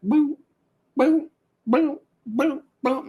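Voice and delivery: high Pong blips; imitated game beeps. Host recalling sitting in front of Pong, imitated beeps. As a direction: mimic the high blips of an old video game.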